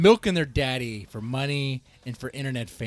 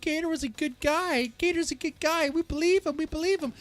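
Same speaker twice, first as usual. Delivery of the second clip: mocking; 13 semitones higher than usual. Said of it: "So many guys would eat up Gator's words".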